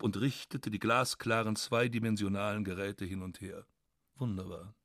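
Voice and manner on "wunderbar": much softer, lower wonder; hushed delighted murmur; soft marvelling. Real, not acted. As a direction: breathe it out in quiet awe. Repeated softly in delight.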